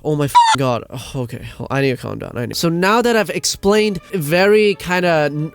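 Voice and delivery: normal talking voice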